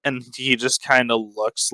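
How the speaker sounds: ordinary delivery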